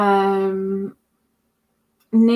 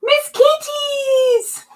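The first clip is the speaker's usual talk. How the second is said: excited shout; 7 dB louder, much slower than usual. Real, not acted. Greeting a chat member.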